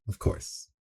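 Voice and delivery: breathy whispered disclaimer; whispered aside. Real, not acted.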